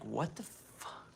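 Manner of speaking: slow, hushed disbelief; shocked. Retold or imitated. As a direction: let it drop out in stunned shock. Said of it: "and I'm like".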